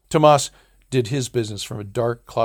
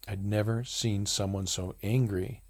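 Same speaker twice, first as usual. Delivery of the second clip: plain reading voice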